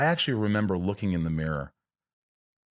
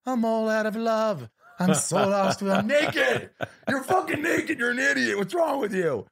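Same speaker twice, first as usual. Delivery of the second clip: much higher, hammed-up wailing voice laughing at himself; mock self-mocking sing-song; 13 semitones higher, slower than usual. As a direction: belt it out high and silly, then laugh and yell at yourself. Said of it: "I'd start to laugh after the cry".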